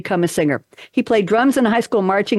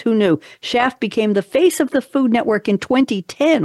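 ordinary delivery

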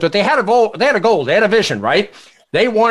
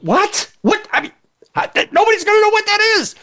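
mock disbelief. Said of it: "the guy looks at him and goes".

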